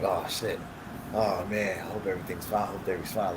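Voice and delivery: low, hushed retold worry; worried self-talk. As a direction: mutter it softly to yourself. Retelling his worried self-talk.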